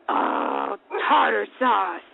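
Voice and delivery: low, drawn-out mock dismay; lightly put-on cartoon-style disappointment; imitated toddler quoting SpongeBob. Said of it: "that's what SpongeBob says".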